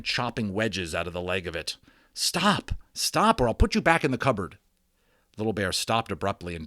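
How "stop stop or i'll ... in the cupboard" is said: shouting stop. Acted. Omri shouts at Little Bear.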